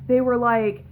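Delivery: normal talking voice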